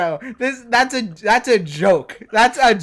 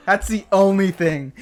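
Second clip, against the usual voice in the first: ordinary talk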